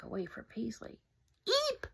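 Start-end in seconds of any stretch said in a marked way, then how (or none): 1.5-1.9 very high eep; high shout. Acted. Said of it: "shouted Silas".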